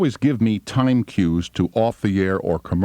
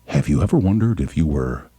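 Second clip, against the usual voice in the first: mock psychologist voice. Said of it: "And Doctor Psychobabble said".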